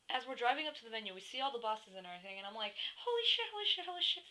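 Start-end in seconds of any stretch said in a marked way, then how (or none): 3.0-4.2 high-pitched excited gush of disbelief; retold excited freak-out. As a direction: blurt it on repeat, pitched way up. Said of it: "freaking out, right?".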